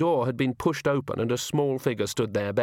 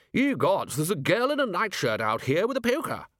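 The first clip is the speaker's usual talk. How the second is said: startled, higher posh guest; surprised gentleman; 8 semitones higher than usual. Acted. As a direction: exclaim at the strange sight. Guest exclaims at door.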